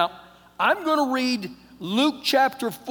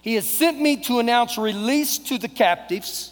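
emphatic preacher's reading, building louder; forceful scripture reading; 2 semitones higher, 4 dB louder than usual. Acted. Preacher reading Luke to congregation, forceful proclamation. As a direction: proclaim each phrase, building to a punch.

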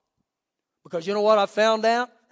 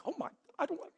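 much softer, higher fretting; mock dismay; 7 semitones higher, 14 dB quieter, much faster than usual. Preacher recalls missing his phone halfway to work, hushed mock dismay. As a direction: squeak it out under your voice.

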